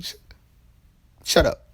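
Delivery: mock snap. Sakura snaps at Naruto.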